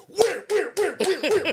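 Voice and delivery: high put-on barking voice repeating where; hammed-up angry questioner; imitating a shouting interrogator; mock interrogator. Interrogator shoving him against wall.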